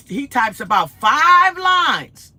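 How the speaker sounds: slow, loud, high stress on five lines; outraged emphasis. Real, not acted. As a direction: hammer out five lines in disbelief. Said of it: "outraged retelling".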